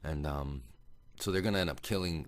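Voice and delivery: ordinary talk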